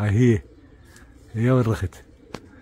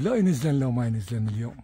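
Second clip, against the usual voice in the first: ordinary talk, nothing marked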